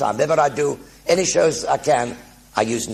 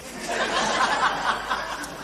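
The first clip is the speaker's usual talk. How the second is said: real laugh; 12 semitones higher, 4 dB quieter than usual. Real, not acted. Laughing about tea cozy story.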